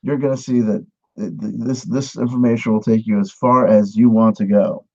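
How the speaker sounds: ordinary talk, nothing marked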